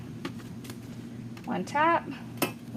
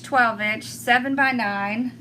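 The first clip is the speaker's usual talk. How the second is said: ordinary talk, nothing marked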